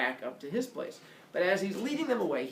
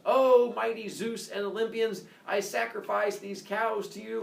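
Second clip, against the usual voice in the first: loud, raised ceremonial proclamation; mock-solemn invocation; 6 semitones higher, 3 dB louder than usual. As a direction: proclaim it grandly to the gods. Baby offers sacrifice to gods.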